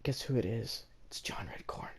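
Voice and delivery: hushed whisper, like a secret; conspiratorial whisper to the viewers. Pretend. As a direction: whisper the name to build suspense. Teasing reveal of the bully.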